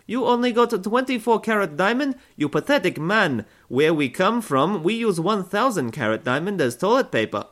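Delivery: high, put-on Russian bride voice, scornful; bad Russian accent. Russian bride mocking a poor suitor, bad Russian accent. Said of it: "their voice apparently sounds like a dodgy teenager trying to do a bad accent".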